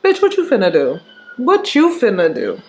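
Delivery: low, taunting mock dare; mock challenging look. Imitating a silent look daring his girl to act. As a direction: egg her on under your breath. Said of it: "I'm looking at her like that".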